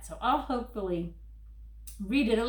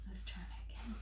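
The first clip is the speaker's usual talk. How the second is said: soft conspiratorial whisper; 14 dB quieter, faster than usual. Acted. Reader asks the kids to try again, whispering. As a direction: whisper the invitation. Hushed aside.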